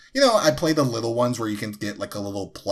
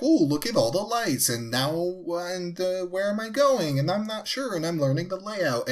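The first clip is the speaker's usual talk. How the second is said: mock dazzled tourist; 9 semitones higher than usual. S1 mimics dazed arcade-goer.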